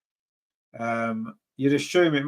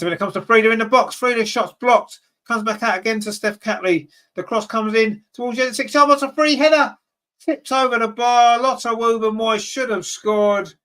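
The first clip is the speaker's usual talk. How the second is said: climbing higher and louder to the free header; excited commentary; 7 semitones higher, 4 dB louder than usual. Real, not acted. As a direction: call it like the goal must come. Header tipped over the bar.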